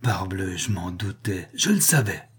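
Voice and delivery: restrained outburst of confirmed suspicion; sudden exclamation. A narrator's reading. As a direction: start low, then burst out on the last words. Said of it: "Tout à coup, il s'écria".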